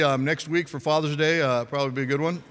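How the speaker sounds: ordinary delivery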